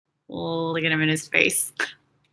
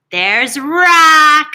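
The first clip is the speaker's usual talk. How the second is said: higher, louder delighted announcement; big excited there's Rock; excited reveal; 6 semitones higher, 10 dB louder than usual. Real, not acted. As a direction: present him proudly.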